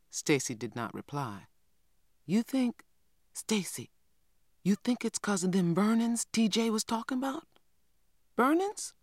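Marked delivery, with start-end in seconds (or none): 2.2-7.5 put-on higher, pressing girl's voice; a curious child pushing her brother for answers; eager young girl. 8.4-8.9 a sharp, much higher piping question; a small boy piping up in surprise; piped up. Acted. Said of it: "piped little man".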